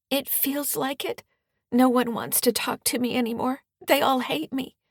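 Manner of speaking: high, hurt and despairing; lightly voiced tearful teen; upset teenage girl. Distraught daughter after bullying.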